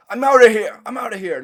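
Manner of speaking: a badly faked southern accent, loud at first; attempted southern accent. Vlogger botching a southern accent, loud then fading. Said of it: "I can't do the fucking southern accent at all".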